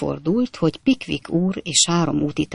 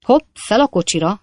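brisk, choppy braggart voice, louder; boastful Jingle; 5 semitones higher, 5 dB louder than usual. Acted. Stranger brushing off the coachman fight, boastful rattle. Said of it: "mondta az idegen".

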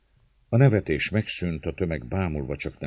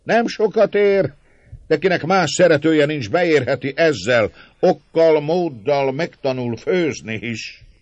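loud, high auctioneer-like call; hawking shout; 12 semitones higher, 10 dB louder than usual. A narrator's reading. Márton offering the lady to the lads, loud jeering shout. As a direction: cry her out to the crowd as if on offer. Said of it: "Kiáltta a tömeghez fordulva".

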